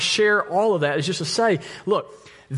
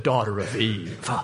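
low, slow, hushed and tender; softly and tenderly; 8 semitones lower, 4 dB quieter, slower than usual. Retold or imitated. Mock gentle Paul comforting the girl, low and slow. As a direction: coo it gently like a loving father. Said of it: "Paul softly and tenderly stroked her hair and said".

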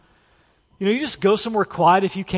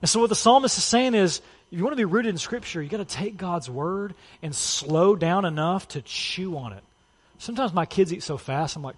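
ordinary delivery

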